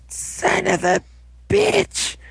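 high, broken-up groaning curse; mock pained curse. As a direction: curse like a downed hero, hammed up. Acted curse while lying beaten.

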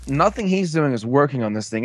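usual speaking voice

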